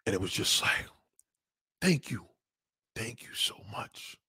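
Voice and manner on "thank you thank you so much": soft, breaking into a whisper; hushed, overcome gratitude, acted out; retold tearful thanks. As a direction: re-enact crying thanks, fading to a slow whisper. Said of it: "And as I'm crying".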